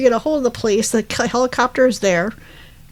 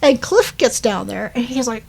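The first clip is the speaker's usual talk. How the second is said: ordinary talk, nothing marked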